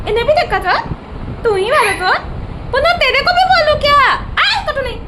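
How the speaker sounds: high gentle scold to an angry parrot; pet scolding. Real, not acted.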